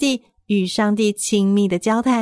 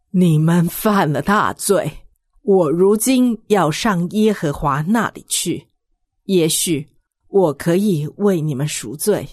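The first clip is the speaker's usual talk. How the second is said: Moses voice; 4 semitones lower than usual. Acted. Moses speaks to people.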